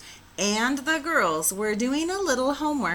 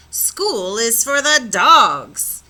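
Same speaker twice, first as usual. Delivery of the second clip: loud, slow and firm, every word planted; emphatic; 2 semitones higher, 9 dB louder, slower than usual. Acted. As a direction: lay down the law to the kids. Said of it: "stated Mama Junebug Jones emphatically".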